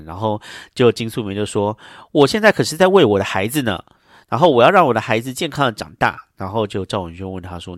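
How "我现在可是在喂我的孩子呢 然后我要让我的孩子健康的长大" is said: higher, a bit louder retort; lightly voiced indignant woman; mock indignant. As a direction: defend yourself with a huff. Pregnant woman defending her burger.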